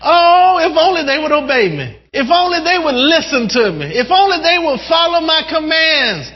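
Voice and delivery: high, eager voice of God; impassioned pleading, pitch climbing high; excited exclamation. Acted. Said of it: "So He gets excited".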